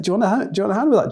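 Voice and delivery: high, teasing offer of help; mock helpful. Coworkers teasing Jeff carrying the heavy box, high mock-helpful offer. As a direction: sweetly fake concern.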